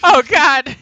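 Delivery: a laughing, loud, high "Oh, God!"; laughing exclamation. Real, not acted. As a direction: cry out through real laughter. GM reacts to mimic joke.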